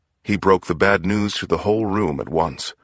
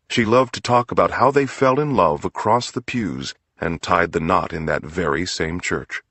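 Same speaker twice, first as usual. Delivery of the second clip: ordinary delivery, nothing marked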